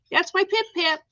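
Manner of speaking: high cooing with stress on "Pip"; pet talk. Real, not acted. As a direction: coo the name "Pip Pip" to your pet bird.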